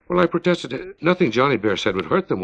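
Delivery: ordinary delivery, nothing marked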